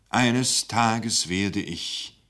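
very slow, halting start. Acted. Speaker hesitates over his grave news, very slow. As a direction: drag it out, then break off. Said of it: "er stockte".

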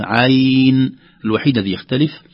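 usual speaking voice

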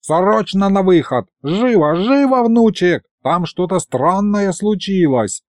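put-on high-pitched, agitated old man; urgent summons. A narrator's reading. Grandfather summons grandson to strange incident, high and urgent.

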